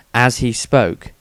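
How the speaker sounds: ordinary delivery, nothing marked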